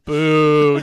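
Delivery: loud heckler's boo; jokey, full-volume jeer; mock booing. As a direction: boo loudly like an audience member. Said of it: "Just one guy boos you".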